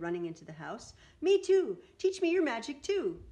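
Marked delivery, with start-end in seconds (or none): 1.2-3.2 loud, high eager shout. Acted. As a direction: beg loudly like an excited boy. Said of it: "he shouted".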